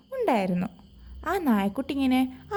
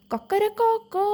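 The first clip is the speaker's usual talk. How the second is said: rooster crow; 8 semitones higher than usual. Retold or imitated. Rooster shows the puppy his only call, high crowing. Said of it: "എന്ന് പറയാനേ അറിയുള്ളൂ".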